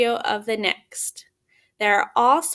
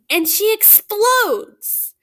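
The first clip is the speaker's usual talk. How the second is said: higher, louder, slowed "and she explodes"; dramatic explosion; 9 semitones higher, 5 dB louder, slower than usual. A narrator's reading. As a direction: let it burst out big and slow. Story climax, finger smashed.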